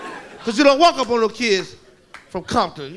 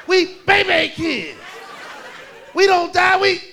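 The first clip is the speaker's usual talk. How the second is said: put-on three-year-old tough kid; 7 semitones higher, slower than usual. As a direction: shout it proudly like a gang slogan from a tot. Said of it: "the baddest one was three years old".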